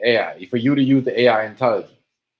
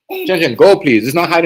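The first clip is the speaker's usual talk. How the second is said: firm louder call; 4 semitones higher, 7 dB louder than usual. Real, not acted. Sending child away, louder.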